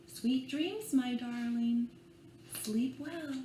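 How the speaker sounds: put-on gentle read-aloud for small children; bedtime story reading. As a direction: lull them along with the rhyme. Reads picture book aloud.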